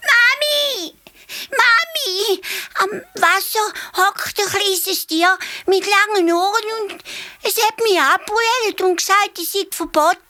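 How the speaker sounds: put-on very high wailing child voice; crying elephant calf. A narrator's reading. Calf runs crying to its mother, very high. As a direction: wail for mommy through tears. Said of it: "Es trompetet schon von weitem".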